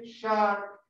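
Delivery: shark slowly, tongue curled back on the R; English curled R. Shown as a demonstration. Teacher models the word tiburón in English, shark with curled R. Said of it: "la lengua enrollada".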